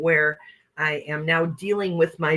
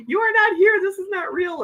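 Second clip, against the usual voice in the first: put-on high, frightened child's denial; scared child insisting. Child under the blankets denies the spirit, high scared voice. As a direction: tell the ghost it isn't real, high and scared. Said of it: "or whatever I said".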